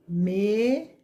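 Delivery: "Mesa" split into "me" and "sa", each drawn out; syllable by syllable. Shown as a demonstration. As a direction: stretch "me", long pause, then "sa" in "Mesa". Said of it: "recortarmos as sílabas".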